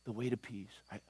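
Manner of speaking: a sorrowing wish, barely above a murmur; grieving. Acted. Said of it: "He says".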